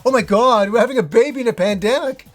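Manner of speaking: half-laughing shock at the timing; stunned disbelief. Retold or imitated. Reacting to baby amid pandemic.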